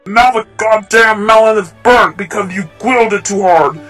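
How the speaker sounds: loud, furious telling-off; angry shouting. Acted. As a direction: shout at the friend who ruined the food. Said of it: "You didn't need to shout at me".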